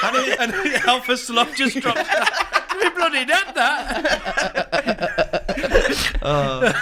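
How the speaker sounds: high, laughing through the words; laughing while talking. Real, not acted. Laughing while retelling the slug drop. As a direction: giggle out the words.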